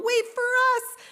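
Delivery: high, loud cry to wait; yelled. A narrator's reading. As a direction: shout after the others not to be left. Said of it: "two more drops yelled".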